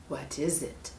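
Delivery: menacing snake. Acted. Snake hints at the monkey's fate, low. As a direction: let the threat hang quietly.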